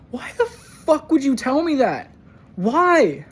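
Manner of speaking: high, loud cry of disbelief; anguished outburst. Retold or imitated. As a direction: yell it like the confession just stung. Anguished outburst at retold confession.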